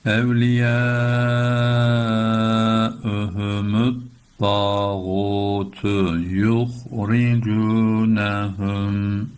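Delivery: melodic tajweed chant; Quran recitation. Shown as a demonstration. Teacher chanting the verse for students, melodic. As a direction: chant the line with held vowels.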